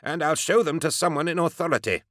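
high, haughty, piqued retort; snappish. A narrator's reading. Haughty doctor refuses the gunman his papers, snappish. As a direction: snap back with querulous authority. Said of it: "Amos snapped".

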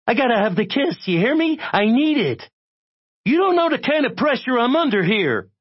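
very high, frantic pleading; desperate, high-strung caller; breathless rush. A narrator's reading. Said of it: "one long, breathless rush".

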